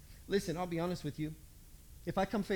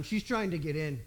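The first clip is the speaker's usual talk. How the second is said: ordinary delivery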